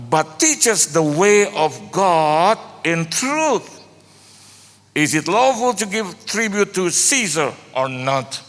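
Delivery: slow, measured reading of an English verse; preacher reads the verse out to the church slowly; scripture reading. Acted.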